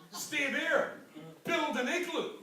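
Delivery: laughing. Real, not acted.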